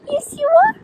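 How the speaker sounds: very high squeaky coo. Real, not acted. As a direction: squeal it to the puppy. Cooing to her dog.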